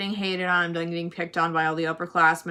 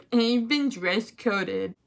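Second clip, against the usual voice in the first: slow, whimpering imitation; mock tearful confession; 2 semitones higher, much slower than usual. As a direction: whine it slowly to dad. Tearful student phoning dad, retold.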